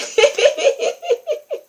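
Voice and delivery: high delighted laugh. Real, not acted. Laughs at her own success.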